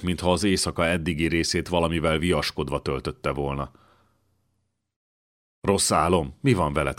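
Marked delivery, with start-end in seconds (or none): none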